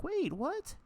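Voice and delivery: squeaky surprise. Retold or imitated. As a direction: yelp it in sudden shock. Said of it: "and I was like".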